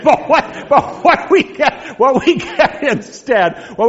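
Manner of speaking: high, loud, slow words through laughter; laughing through words. Real, not acted. Teacher trying to resume the lesson, laughing. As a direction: push on while still laughing. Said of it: "laughing through restart".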